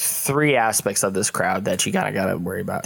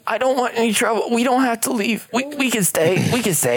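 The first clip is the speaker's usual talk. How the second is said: timid prisoner voice; 12 semitones higher than usual. Acted.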